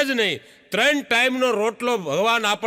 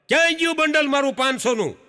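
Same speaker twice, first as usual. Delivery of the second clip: frantic search; 5 semitones higher than usual. Retold or imitated. Husband hunting for lost money.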